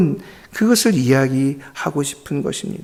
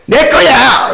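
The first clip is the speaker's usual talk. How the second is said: loud, high forceful claim; say it harder; 8 semitones higher, 5 dB louder, much slower than usual. Shown as a demonstration. Pastor coaching the little rabbit, loud forceful claim. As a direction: shout the claim, much stronger. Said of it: "아니, 더 세게".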